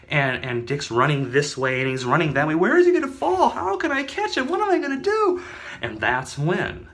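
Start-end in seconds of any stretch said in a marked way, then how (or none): 2.5-5.4 high, loud frantic cop; high panicked voice. Acted. Cop running around worried about the gnome, high and frantic.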